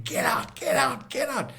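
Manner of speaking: breathless, whispered high-pitched get out, get out; a voiceless scream in the head; inner scream. Retold or imitated. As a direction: hiss the panic your brain was screaming. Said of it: "It was just screaming".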